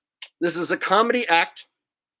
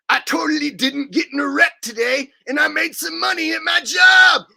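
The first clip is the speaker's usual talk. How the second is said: higher, louder boasting cheer; mock proud driver; 7 semitones higher, 5 dB louder than usual. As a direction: brag about a wreck-free day, hammed up. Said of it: "you're like".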